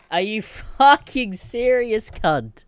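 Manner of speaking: high, slow, stretched outraged question; are you kidding me, angrily; outraged disbelief. Real, not acted. Yelling at Apple's developer, high outraged disbelief.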